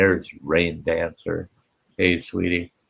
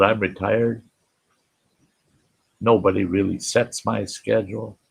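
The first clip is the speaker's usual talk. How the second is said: ordinary talk, nothing marked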